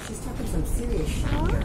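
excited. Real, not acted. Excited crew reacting to interview.

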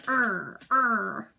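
mock tiger growl. Teacher imitating Henry urging tiger fury, mock growl. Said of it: "be like a tiger".

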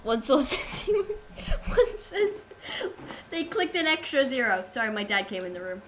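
usual speaking voice

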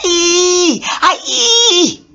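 high shrieking scream with a laugh. Acted. Mariam screaming at the goddess's name, high scream. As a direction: scream out in shock. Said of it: "screamed Mariam".

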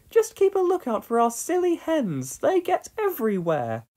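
high, cheerful farm-wife voice; Dorothy voice. A narrator's reading. Dorothy offers Pat paint from the barn. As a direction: offer the paint with homely pride.

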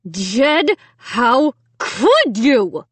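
very slow, tense reproach; demanding through clenched teeth. A narrator's reading. Mom accusing her son, demanding through clenched teeth. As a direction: stretch each word out in controlled anger. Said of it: "she demanded through clenched teeth".

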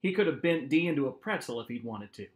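plain reading voice

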